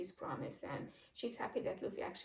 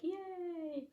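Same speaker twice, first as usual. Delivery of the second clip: cheering; 9 semitones higher than usual. Acted. Townsfolk cheering.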